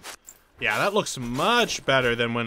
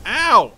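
abrupt loud, high yelp cutting in; startled ow; 7 semitones higher, 11 dB louder than usual. Real, not acted. Gamer hit while saying he's fine, startled yelp. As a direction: yelp as a blow lands mid-thought.

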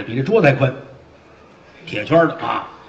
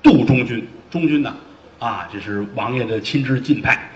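ordinary delivery

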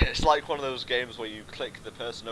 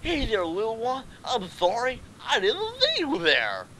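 much higher, acted apology; high character voice, surprised; exclaim, then apologise to a little one; startled pony hero; 10 semitones higher than usual. Acts player pony startled by little filly.

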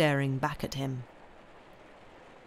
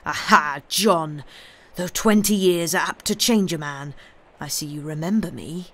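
louder, threatening accusation; menacing; 5 dB louder than usual. Acted. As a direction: spit it at him like a curse. Wronged man confronts his betrayer.

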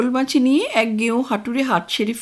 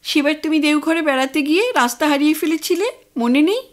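nagging wife; 6 semitones higher, 3 dB louder than usual. Acted. Wife nags husband about directions.

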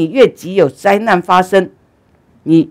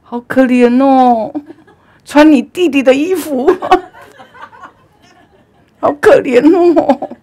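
put-on amused old lady cooing with pity; old lady's voice; 9 semitones higher, 6 dB louder than usual. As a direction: coo it like a doting old woman. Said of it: "这老太婆就讲话了".

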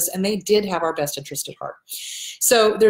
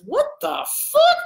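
high-pitched imitation of disbelief; mock outrage; 13 semitones higher than usual. Daughter-in-law to the son about his mother, acted high-pitched outrage. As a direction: retell it as an incredulous high squeal.

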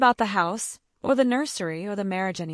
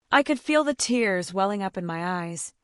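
usual speaking voice

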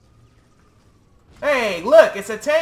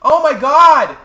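loud exasperated cry; 6 semitones higher, 11 dB louder, slower than usual. Real, not acted. Frustrated exclamation in game.

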